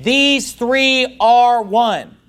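"These three are one" drawn out word by word; slow, emphatic declaration. Acted. Quotes the verse emphatically.

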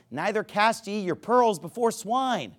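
usual speaking voice

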